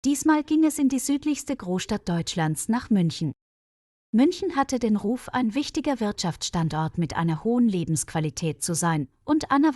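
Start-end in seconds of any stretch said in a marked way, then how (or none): none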